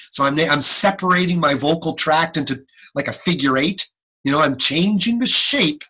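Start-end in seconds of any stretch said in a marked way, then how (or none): none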